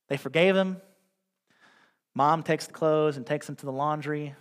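normal talking voice

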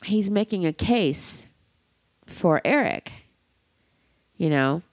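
normal talking voice

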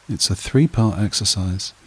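ordinary talk, nothing marked

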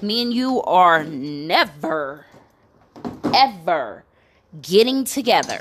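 firm, slowed kiss-off stressing never ever; emphatic. Real, not acted. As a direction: spell it out word by word, done with him. Telling off an unwanted suitor.

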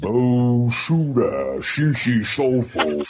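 very slow Huttese gibberish; Jabba the Hutt voice. Retold or imitated. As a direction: drawl like a giant slug. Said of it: "the Jabba the Hutt one".

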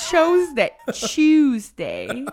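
put-on a playful British accent on "Tuesday", said TYOOZ-day. Girlfriend shows off her only British-accent word, Tuesday, in a playful British accent. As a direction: show off the one British word "Tuesday" she knows. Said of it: "That's the only thing I know how to say".

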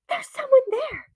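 high, urgent stage whisper; breathy, high-pitched panicked whispering; frightened whisper. A narrator's reading. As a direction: whisper it forcefully in alarm. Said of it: "she whispered".

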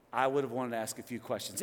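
ordinary talk, nothing marked

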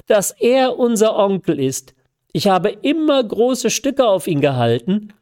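delighted. Acted. Young man thrilled about his new uncle, delighted. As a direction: gush with joy. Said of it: "versetzte ich ganz entzückt".

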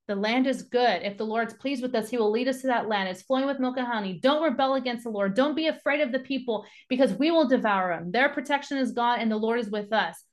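brisk run-through of a quote; fast, matter-of-fact summary; quick recap. Shown as a demonstration. Quick recap of verses just read.

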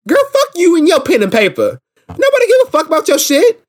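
high-pitched indignant screech; squealed, incredulous telling-off; exasperated outburst. Real, not acted. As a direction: let the voice shoot way up in outrage. Rant at singer peaks.

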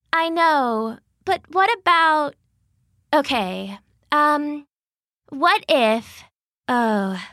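a hint of a bear fumbling for words; Goldie the bear.

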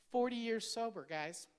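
forty years sober, shouted with pride; proud proclamation. Real, not acted. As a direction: announce it like a victory. Proclaiming dad's sobriety.

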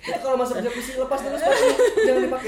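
high, loud mock scolding; scolding mother. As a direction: yell at the kids like a fussy mum.

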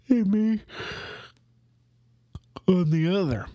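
high yawn, trailing into breathy whisper; words swallowed by a big yawn. Real, not acted. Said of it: "Again, I apologize".

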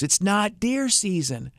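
put-on high, slow, upset kid; a shocked boy protesting; boy's voice. Son scolds his dad for shooting out of season, high. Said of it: "the boy goes".

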